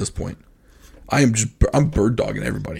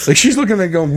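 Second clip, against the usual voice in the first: normal talking voice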